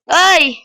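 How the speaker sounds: sharp high shriek; scream. Real, not acted. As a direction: shriek on the fall. Falling again, screams. (tili habang nahuhulog)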